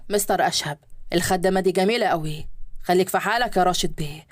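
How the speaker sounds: men's voices in a read story; low male guests. Acted. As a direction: play the businessmen, voice dropped low. Men at the party, voiced low.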